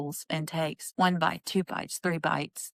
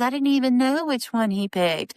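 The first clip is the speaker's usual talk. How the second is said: louder, higher, earnest protest; mock earnest denial; 6 semitones higher, 5 dB louder than usual. Wife insists to Mary she didn't know, mock serious. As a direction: insist on it with a straight face. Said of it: "she's so serious when she says that".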